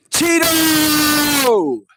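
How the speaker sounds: very high loud shout; yell. Real, not acted. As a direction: scream it out on request. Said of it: "if you yell Cheeto".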